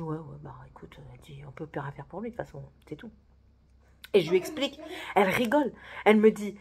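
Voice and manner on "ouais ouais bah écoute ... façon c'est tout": hushed, quick whispered gossip; resigned secret aside; conspiratorial whisper. Retold or imitated. Manager whispering that nothing can be done for him.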